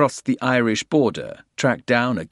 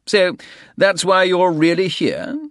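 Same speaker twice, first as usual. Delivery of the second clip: much higher, louder realisation; sharp realisation; 11 semitones higher, 7 dB louder than usual. A narrator's reading. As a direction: catch on suddenly. Soames confronts them.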